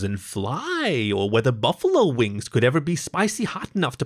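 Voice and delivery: swooping pitch, climbing to a high emphatic end; building comic riff. A narrator's reading. Topher imagining cat thoughts for LaShonda, building excited riff.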